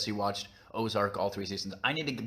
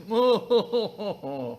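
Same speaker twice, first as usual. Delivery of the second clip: high, loud mock upper-class chortle; hammed-up British toff laugh; 11 semitones higher, 6 dB louder than usual. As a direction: laugh like a caricature aristocrat. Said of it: "I assume it is".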